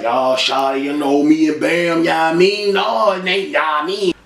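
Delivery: lightly voiced street rapper. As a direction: play the rapper, drawling the ya mean. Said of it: "[name], he like".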